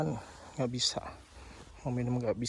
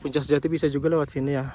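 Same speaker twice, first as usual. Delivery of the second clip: shouting over wind; 2 semitones higher, 10 dB louder, faster than usual. Real, not acted. Strong wind on the summit.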